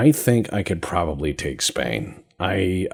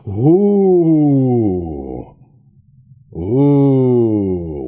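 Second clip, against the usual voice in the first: long, loud oohs gliding down; excited ooh; 5 semitones higher, 7 dB louder than usual. Real, not acted. Player marvelling at light cruisers with multiple guns, excited oohs. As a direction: draw out a big, high, impressed ooh.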